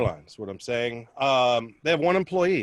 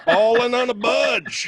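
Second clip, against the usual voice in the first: punchline shout; 12 semitones higher, 7 dB louder, slower than usual. Acted.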